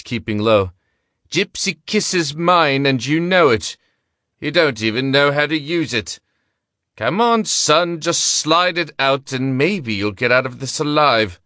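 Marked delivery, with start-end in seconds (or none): 1.3-6.2 gambler voice. A narrator's reading. Gambler taunting Gaston in gunfight. 7.0-11.4 coaxing gambler. A narrator's reading. Gambler coaxing during gunfight.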